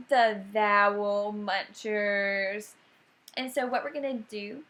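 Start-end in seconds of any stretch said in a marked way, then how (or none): 0.1-2.7 slow showy announcement. Shown as a demonstration. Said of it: "so cute".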